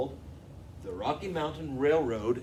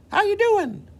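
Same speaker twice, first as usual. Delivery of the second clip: much higher, loud chirpy engine voice; Old Pete voice; 14 dB louder than usual. Acted. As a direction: greet the children as Old Pete. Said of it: "Old Pete".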